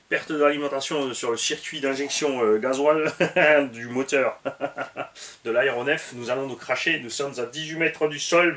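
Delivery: laughing mock pilot radio report; hammed-up emergency call, half laughing; pilot cockpit headset.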